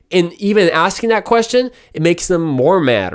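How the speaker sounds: ordinary talk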